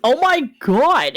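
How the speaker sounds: loud, high, drawn-out mock cry; exasperated character. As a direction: exclaim slowly after another miss. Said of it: "He's like".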